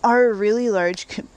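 hiccups breaking the words; speaking through hiccups; hiccuping. Real, not acted. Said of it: "I got the hiccups or something".

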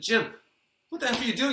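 high-pitched, incredulous retold shout; alarmed call. Storyteller replaying his alarm at the neighbor's ladder, alarmed call. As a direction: call out in disbelief, pitch jumping up.

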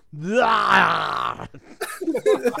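long, loud strangled yell; hammed-up action-hero roar; drawn-out high "aaargh", mock agony; Schwarzenegger. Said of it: "that's the line that's in every Schwarzenegger film".

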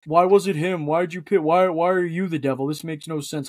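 hurried pile-up of mock gripes; mock complaining fans. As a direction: imitate griping online fans, far from your own voice. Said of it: "People would complain".

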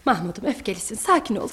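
low, soft appeasement; calming. Acted. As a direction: gently talk down an angry son. Mother calms her angry son. (sakinleştirici, alçak sesle)

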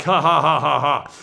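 low, booming giant's "ha ha ha"; hammed-up villainous laugh; mock Goliath laugh. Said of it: "There's Goliaths that are like".

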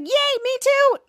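high, gleeful acted yay; excited cheer. Charlotte joining the celebration, high happy cheer. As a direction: squeal along with delight.

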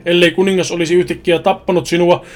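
loud, mocking demon taunt; devil voice. The devil taunting Makrades and Luna in a loud mocking demon voice. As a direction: loom over them and sneer. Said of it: "samalla lausuen kivuliaita sanoja".

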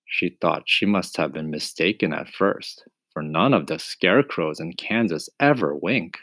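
plain reading voice

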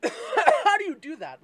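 high, loud laughing disbelief; loud disbelieving laugh. Real, not acted. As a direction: laugh out the question in shock. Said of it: "that was loud".

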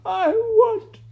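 loud, very high sobbing wail. A narrator's reading. Maid sobbing before rat and princess, piercing wail. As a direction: bawl it at the top of your range. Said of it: "sobbed Midge".

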